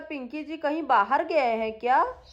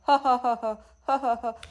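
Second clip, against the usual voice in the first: laughing. Acted. Laughing at baby asleep in lap.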